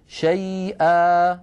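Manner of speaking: شيئا slowly as "shay'a", final a long; slow "shay'a" with no nun; pausal alif. Shown as a demonstration. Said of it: "أما في الوقف شيئا".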